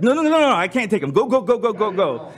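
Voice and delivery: high, rapid-fire panicked pleading; mock panic. Passenger begging friend to drive off, mock panic. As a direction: urge the driver to flee, hammed-up. Panicked plea to flee big man.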